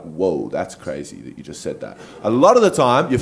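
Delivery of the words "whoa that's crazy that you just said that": low, quiet stunned awe; amazed whoa. Retold or imitated. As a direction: drop the voice in disbelief. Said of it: "you're like".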